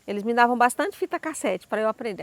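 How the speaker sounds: ordinary talk, nothing marked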